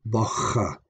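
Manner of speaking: bocha with a throaty ch between the vowels; fricative ch. Shown as a demonstration. Teacher showing students the ch sound in bocha, fricative. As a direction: make the middle of bocha a fricative. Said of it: "the fricative sound is the ch sound".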